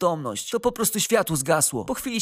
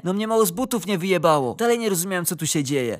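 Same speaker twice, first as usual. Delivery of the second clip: plain reading voice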